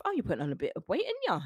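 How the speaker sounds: quick Cockney nagging, swooping high on the tag question; mock Cockney scolding, jumping up at the end; Cockney accent. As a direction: put on a cheeky Cockney parent's accent. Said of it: "Cockney accent".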